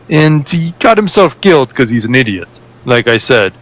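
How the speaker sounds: a mild foreign lilt telling about his thieving cousin; a hint of Yar's accent carried through the tale; accented NPC. NPC keeps telling his story.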